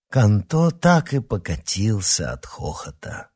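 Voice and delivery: plain reading voice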